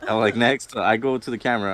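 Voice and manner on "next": louder, breezy next; mock casual call. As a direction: brush it off like nothing happened. S0 echoes the joke louder.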